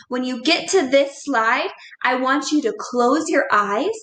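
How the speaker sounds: normal talking voice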